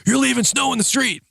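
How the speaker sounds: high-pitched mock yelling; retold angry yell. Man yells at plow driver about snow, retold high mock yell. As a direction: imitate an irate man yelling, pitch jumped way up. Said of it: "yelling at me about plowing".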